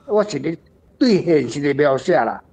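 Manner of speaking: usual speaking voice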